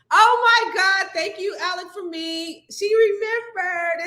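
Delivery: high, slow, gushing thanks; delighted surprise. Real, not acted. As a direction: squeal it out, touched. Reacting to birthday greeting.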